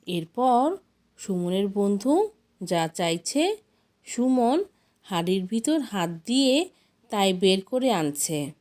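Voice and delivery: ordinary delivery, nothing marked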